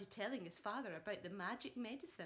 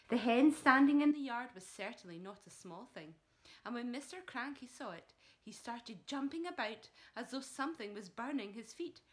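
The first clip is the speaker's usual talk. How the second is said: ordinary delivery, nothing marked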